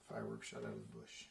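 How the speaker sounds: low, quiet repeat. Acted. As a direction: drop right down in pitch. Repeated stage line, lowered take.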